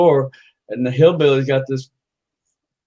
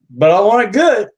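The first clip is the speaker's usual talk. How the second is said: louder, higher demand; insistent; 7 semitones higher, 6 dB louder than usual. Acted. Customer insisting to the brothel keeper, emphatic. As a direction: press the point eagerly.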